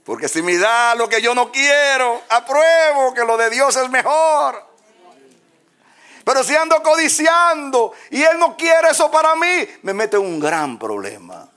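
high, loud preacher's shout; fiery revival preaching; preaching at full force. Acted. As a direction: proclaim it to the congregation.

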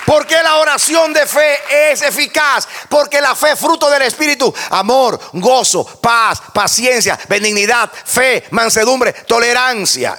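high, loud shouted sermon; fiery preaching. Acted. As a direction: preach it at the top of your lungs to the congregation. Preacher rises to full force.

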